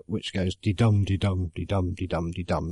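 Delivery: de-dum chanted softly, each dum stressed; iambic rhythm. Shown as a demonstration. Showing viewers the iambic pentameter beat, soft rhythmic chant. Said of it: "this thing called iambic pentameter".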